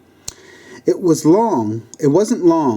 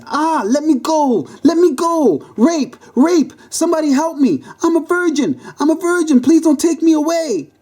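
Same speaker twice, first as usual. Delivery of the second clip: high-pitched frantic scream; panicked, high-pitched protest. Acted. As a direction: scream for help at the top of your range. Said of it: "then screamed".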